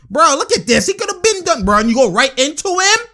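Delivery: high-pitched exasperated disbelief; incredulous. Real, not acted. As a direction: protest the bad play, voice shooting up. Incredulous at a bad play.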